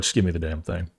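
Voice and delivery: low, grumbled demand like a fed-up father; a lightly voiced gruff dad; impatient dad. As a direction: mutter it like a dad out of patience. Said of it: "my dad would be like".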